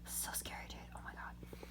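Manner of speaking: whispered, under the breath; hushed dread. Real, not acted. Remembering the dark woods, hushed scared whisper. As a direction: whisper it as an aside, still spooked.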